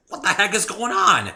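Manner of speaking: high, loud alarmed cry; panicked shout. Acted. Panicked by pain.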